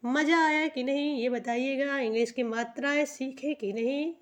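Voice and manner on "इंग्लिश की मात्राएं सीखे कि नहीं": ordinary talk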